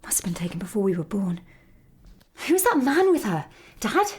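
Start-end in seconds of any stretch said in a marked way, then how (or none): 0.0-1.4 quick unvoiced whisper; hushed fast whisper over an old photo. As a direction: breathe it out, fast and secretive. Studying a faded photo.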